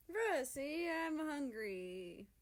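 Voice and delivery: high, slow drawn-out whine; whining. A narrator's reading. America to Russia, slow hungry whine. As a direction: beg like a spoiled child. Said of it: "America whines".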